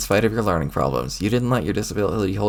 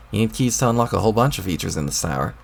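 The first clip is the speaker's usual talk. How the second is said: usual speaking voice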